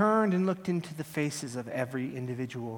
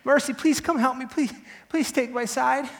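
high, loud, desperate plea; crying out; 9 semitones higher, 6 dB louder than usual. Acted. Fallen defendant calling Mercy to his side, desperate cry. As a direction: cry out for help at a raised pitch. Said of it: "and I cried out".

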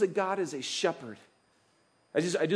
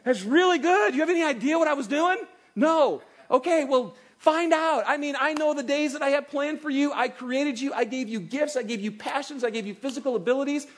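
a higher, delighted voice of God; God's voice; 6 semitones higher, 4 dB louder than usual. Pretend. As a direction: speak as a proud, loving creator. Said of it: "And God goes".

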